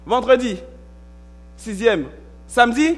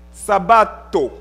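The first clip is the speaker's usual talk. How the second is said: sabato said with an Italian accent, slowly; 5 dB louder, slower than usual. Shown as a demonstration. Preacher showing the Italian word in an Italian accent. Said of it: "Pardonnez mon accent italien".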